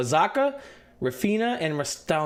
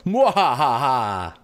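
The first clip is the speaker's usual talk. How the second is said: evil villain cackle; 2 semitones lower, 6 dB louder, much slower than usual. Acted. Villain laugh.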